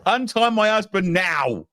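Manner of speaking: high-pitched pretend wife; hammed-up demand in a high voice, slowing at the end; mock indignant wife. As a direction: play an outraged missus, high. Voicing an angry wife in chat.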